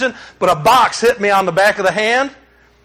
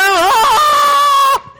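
high shout of pain; a sudden high yelp as the hand is pulled; cry of pain; 4 dB louder than usual. Retold or imitated. Pastor reliving the conveyor accident, high pain shout.

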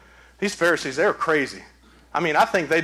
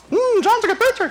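high-pitched talking parrot; 4 dB louder than usual. Acted. Trained parrot praising the preacher, in a high bird voice. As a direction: mimic a parrot reciting praise. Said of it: "Say it again, Polly".

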